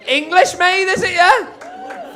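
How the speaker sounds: high, loud mocking yell; comic shouting at an audience member. Real, not acted. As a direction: bellow it up the scale at him.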